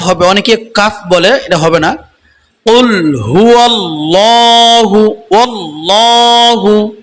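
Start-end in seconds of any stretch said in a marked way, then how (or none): 2.7-6.9 হুয়াল্লাহু with লা stretched one alif, slow chant; tajweed recitation. Shown as a demonstration. Teacher demonstrates the stretch to students, slow chant. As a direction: draw out the লা of ওয়াল্লাহু, chanting slowly. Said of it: "লাম কারা জবর".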